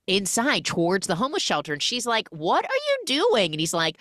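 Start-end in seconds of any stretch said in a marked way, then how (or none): 2.3-3.5 surprised. Retold or imitated. Heroine surprised at shelter.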